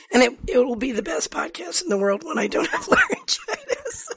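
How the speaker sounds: laughing through the words; amused, laughing at herself. Real, not acted. Joking about her laryngitis.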